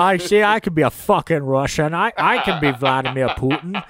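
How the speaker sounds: Scottish movie-star impression; sweary Scottish star's boast; Sean Connery. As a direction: boast as a Scottish actor playing Russian. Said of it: "always wanted Sean Connery".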